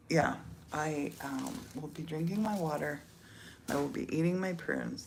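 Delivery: normal talking voice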